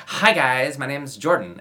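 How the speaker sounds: bubbly everyday hello that breaks the aristocrat role; casual real voice. Shown as a demonstration. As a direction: drop the character and chirp it like a vlog intro. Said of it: "don't go back to your ... real speaking voice".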